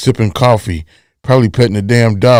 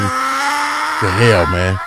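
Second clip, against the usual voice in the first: mock shout; 13 semitones higher, much slower than usual. Mocking missing sound effect guy.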